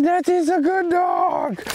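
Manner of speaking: excited cheering praise. Real, not acted. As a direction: cheer the puppy on with a very high shout. Praising the returned puppy.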